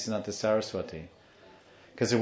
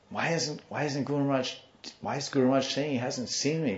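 higher-pitched boy's questioning, lightly acted; hidden boy's puzzlement; 4 semitones higher than usual. Boy under the bed doubting the guru, puzzled. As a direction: raise the pitch and ask in bewilderment, keeping it contained. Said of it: "And he's like".

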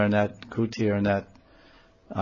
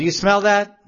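higher and louder, eager question; excited; 10 semitones higher, 7 dB louder than usual. Acted. Nityananda excitedly asking the devotees about the fragrance, eager. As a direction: call out as if catching a wondrous scent.

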